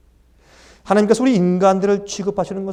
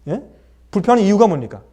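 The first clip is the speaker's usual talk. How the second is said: normal talking voice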